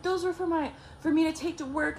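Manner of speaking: high-pitched indignant complaint; retold protest. Ex protests over his lunch.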